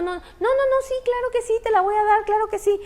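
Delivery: high, flustered 'sí, claro que sí'; overeager hostess. Retold or imitated. Mother reassuring the embarrassed neighbour, high and overeager. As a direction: cover the awkwardness with a high insistent yes.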